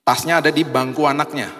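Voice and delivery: normal talking voice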